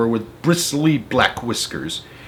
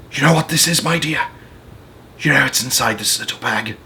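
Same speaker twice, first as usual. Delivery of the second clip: put-on creaky old man; 2 semitones higher than usual. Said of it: "his voice was very slow and creaky".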